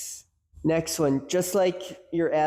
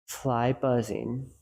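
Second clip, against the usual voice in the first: slow, calm, softer noting; name each sound slowly and evenly; slow, quiet mindful labeling; calm noticing; 2 semitones lower, 4 dB quieter, slower than usual. Shown as a demonstration. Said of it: "calm and centered with it".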